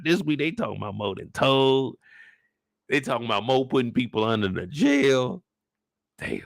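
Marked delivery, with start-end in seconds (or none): none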